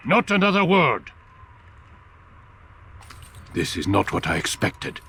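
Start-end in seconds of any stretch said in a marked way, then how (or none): 0.1-1.1 furious shout. Acted. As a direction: cut him off with a furious yell. Al Mualim silences Altair angrily.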